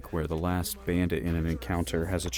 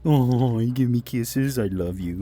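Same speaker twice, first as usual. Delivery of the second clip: doting pet talk; 4 semitones higher, 6 dB louder, slower than usual. Real, not acted. Dog licking him.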